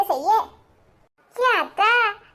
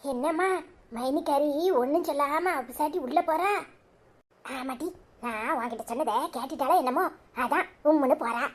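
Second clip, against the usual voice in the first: softer, conspiratorial undertone; hushed gossip; 7 dB quieter than usual. Acted. Mother and daughter gossiping about the sulking daughter-in-law, hushed.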